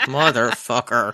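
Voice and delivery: low, slow, flat mutter of a curse; a sour, low aside; deadpan curse. Retold or imitated. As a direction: drop low and drag it out like a beaten man. Randall's reaction, retold.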